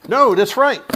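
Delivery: high, loud burst; excited outburst. Real, not acted. Fan relieved at his package, high loud burst. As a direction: blurt it out thrilled.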